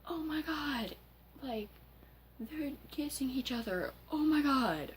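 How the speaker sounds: high-pitched hammed-up horror; imitated scandalized outcry; mock screaming. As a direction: squeal it high, building to a loud finish. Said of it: "like, screaming".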